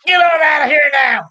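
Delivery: hillbilly shout at a dog. Retold or imitated. Chasing off a stray dog, shouted hill accent. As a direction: yell at the stray dog in thick country voice. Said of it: "you say".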